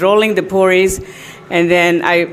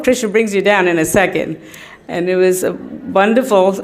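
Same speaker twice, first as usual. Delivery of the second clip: ordinary talk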